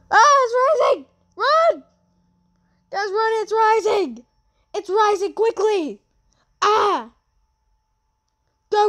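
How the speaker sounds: panicked high shouting; being chased. Real, not acted. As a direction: scream as it catches up. Escaping the rising flood.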